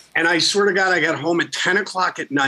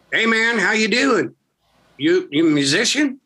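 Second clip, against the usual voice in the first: friendly local; 7 semitones higher than usual. Retold or imitated. Friendly stranger voiced in story.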